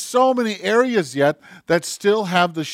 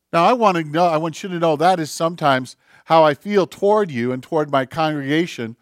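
usual speaking voice

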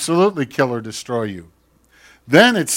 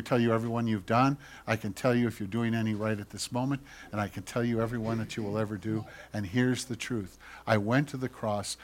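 much softer, lower and tender; God whispering; 4 semitones lower, 8 dB quieter than usual. Acted. God whispering to Bruce, soft and tender. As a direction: hush it like a gentle confession. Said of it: "And God whispers".

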